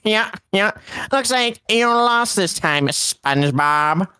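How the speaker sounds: high, slow cartoon squid voice; Squidward voice. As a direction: play a snooty cartoon squid. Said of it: "I'm gonna do the Squidward voice".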